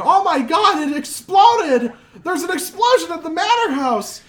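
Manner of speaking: high panicked screaming about the explosion. Acted. Townsfolk screaming to the street about the explosion, panicked yell. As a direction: yell it like the house just blew. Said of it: "people start screaming".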